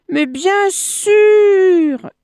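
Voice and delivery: high, loud, drawn-out assurance; over-eager agreement. A narrator's reading. Monkey hiding his fear agrees with the crocodile, over-eager agreement. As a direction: stretch it out high and loud, all too keen.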